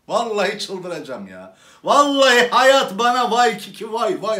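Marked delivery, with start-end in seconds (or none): 1.8-4.4 loud mock lament.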